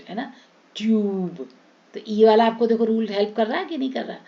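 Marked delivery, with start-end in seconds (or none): 0.8-1.5 ट्यूब with a drawn-out "tyooob"; stretched yoo vowel. Shown as a demonstration. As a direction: hold the "yoo" long in ट्यूब. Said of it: "यू को हम लंबा खींचेंगे".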